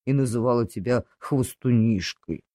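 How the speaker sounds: a deep, sorry piggy apology; pig Khryu-Khryu. Acted. Pig asking the dog's forgiveness, low and sorry.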